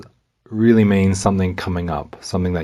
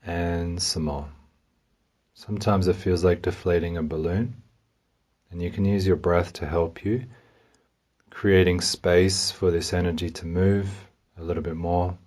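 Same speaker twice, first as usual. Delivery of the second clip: guided meditation; 4 dB quieter than usual. Real, not acted. Meditation guide coaxes listener to release a little more, softly. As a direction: ease them into letting go, bit by bit.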